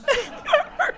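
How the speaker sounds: a sudden high cackle at his own joke; high cackling laugh. Acted. Vulture Mike cackling at his own gag. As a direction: cackle high, pleased with yourself.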